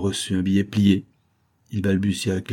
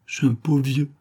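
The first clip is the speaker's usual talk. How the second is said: higher, mildly flustered plea; 6 semitones higher than usual. A narrator's reading. Old worker thinking the boss mocks him, mildly flustered. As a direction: start a plaint in mild disarray. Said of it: "Dans son désarroi".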